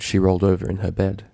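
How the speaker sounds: ordinary delivery, nothing marked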